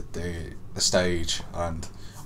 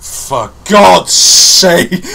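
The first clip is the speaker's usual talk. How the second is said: very slow, louder, higher "For God's sake"; exasperated outburst; 7 semitones higher, 14 dB louder, much slower than usual. Retold or imitated. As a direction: drag it out in exasperation. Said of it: "And I was like".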